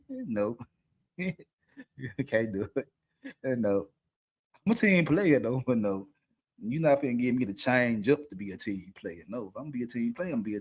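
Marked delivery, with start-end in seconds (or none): none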